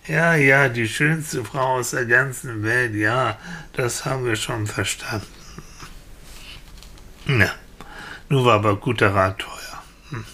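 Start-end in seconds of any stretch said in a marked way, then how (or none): 0.1-5.3 resigned repeat, sinking and softening; weary villagers. Acted. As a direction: brush off what you've heard too often. Villagers wearily repeat boy's words. (genervt und resigniert abwinkend)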